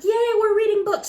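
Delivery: high, loud burst of glee; a big happy yay; excited cheer. Retold or imitated. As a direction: cheer like you just found treasure. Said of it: "And I thought".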